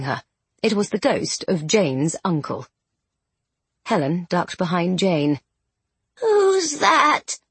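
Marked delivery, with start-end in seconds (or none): 6.2-7.4 rasping, high cry from a sickbed; gravelly voice. Acted. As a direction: croak it out like a dying old woman. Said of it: "came a gravelly voice from the bed".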